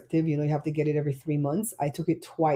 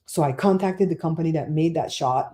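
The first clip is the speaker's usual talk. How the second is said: usual speaking voice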